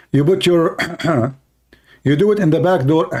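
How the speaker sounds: ordinary delivery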